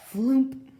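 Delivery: loud comic plop sound; floomp. A narrator's reading. Coco falls in snow.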